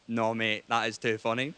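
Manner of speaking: a hint of a tipsy mate egging you on; low mock drunk-brain voice; drunken brain voice. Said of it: "your drunken brain goes".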